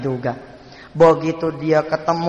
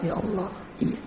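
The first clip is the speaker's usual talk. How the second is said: tearful sigh; 11 dB quieter than usual. Acted. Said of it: "Apa dia bilang? ... sambil nangis".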